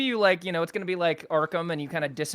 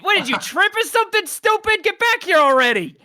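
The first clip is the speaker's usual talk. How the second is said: high, loud goblin shout down the tunnel; yelling goblin; 14 semitones higher, 8 dB louder than usual. Acted. Goblin shouting into the cave for a friend who tripped, high and loud. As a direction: bark it like a rowdy goblin calling a friend.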